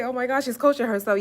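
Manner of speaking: loud, high-pitched mock astonishment; mimicked viewer. Streamer voicing her viewers' reaction, mock amazement. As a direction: exclaim it like an amazed onlooker. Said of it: "You're like".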